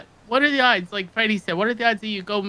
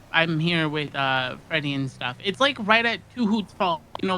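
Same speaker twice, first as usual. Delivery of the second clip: usual speaking voice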